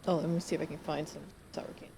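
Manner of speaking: quick unvoiced whisper. Real, not acted. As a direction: whisper it fast, under your breath. Aside while searching for candy.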